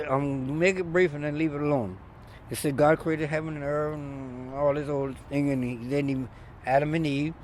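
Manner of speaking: usual speaking voice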